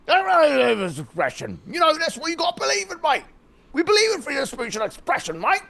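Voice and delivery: high-pitched, hammed-up mate-talk; mock far-right supporter. Far-right man insisting on free speech, mocking imitation. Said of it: "if you ask ten of the far right".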